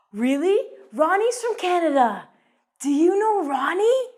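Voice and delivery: gasp, then high, loud eager questions; mock excitement. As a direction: overdo the thrill, pitch climbing to the last question. Acts excited reply to "I'm from Canada.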